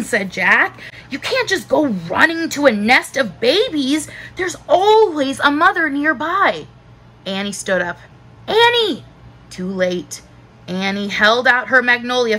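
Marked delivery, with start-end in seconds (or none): 1.1-6.7 higher, emphatic telling-off; exasperated, pitched-up scolding. A narrator's reading. Brother scolding sister. 8.5-9.0 high, sharp call of the name; high-pitched alarmed cry; warning call. A narrator's reading. Boy warning his sister.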